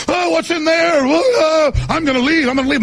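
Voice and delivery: very high, wailing alarm; mock panic. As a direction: imitate a flustered friend fleeing. Retold friend panicking at gun case.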